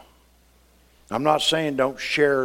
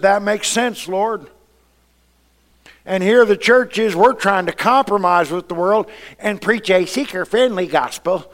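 loud, high-pitched preaching build; preaching at full force; 8 semitones higher, 9 dB louder than usual. Acted. Pastor to church, preaching at full force. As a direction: drive it home like a revival preacher.

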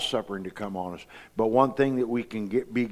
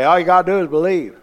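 higher, louder, quick and breezy brush-off; light mocking imitation of a shallow believer; mock glib believer; 7 semitones higher, 10 dB louder, faster than usual. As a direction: mimic people who toss off easy faith. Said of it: "you'll talk to people say".

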